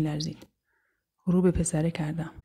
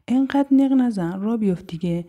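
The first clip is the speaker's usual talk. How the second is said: impatient scolding; 3 semitones higher, 5 dB louder than usual. Acted. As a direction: scold a dawdling friend loudly. Acted impatient scolding of friend.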